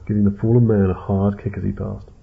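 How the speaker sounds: plain reading voice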